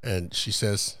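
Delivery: ordinary talk, nothing marked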